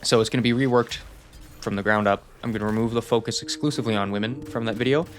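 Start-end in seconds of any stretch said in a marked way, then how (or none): none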